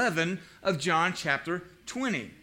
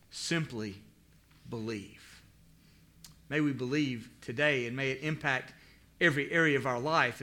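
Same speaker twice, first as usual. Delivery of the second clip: prayer; 4 semitones lower than usual. Acted. Pastor closes prayer before sermon, in a reverent prayer voice.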